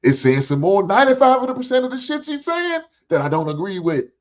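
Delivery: high, loud reaction to a friend's chat; excited outburst. Real, not acted. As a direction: blurt it out, riled up by the comment.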